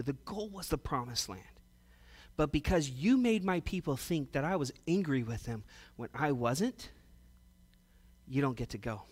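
low, hushed, grave talking-to; quiet rebuke. Retold or imitated. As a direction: take someone aside and softly let them down. God privately rebukes Moses, acted.

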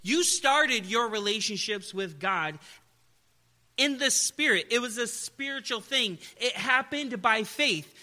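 loud, high sermon delivery; full-force preaching. Acted. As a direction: drive the point home to the congregation.

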